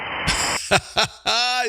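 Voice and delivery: real run of chuckles; amused chuckle. Real, not acted. Host laughs as caller offers to help him out, amused chuckle. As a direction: chuckle along with your pal.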